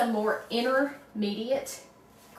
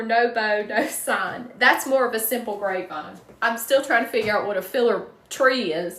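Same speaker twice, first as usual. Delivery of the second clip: ordinary talk, nothing marked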